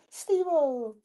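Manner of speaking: high, soft, drawn-out STEVE-O; mock name call. Mock calling the name, softly.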